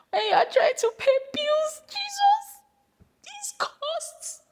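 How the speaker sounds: mock despair. A comic cry about bills and costs. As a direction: squeal it in exaggerated despair.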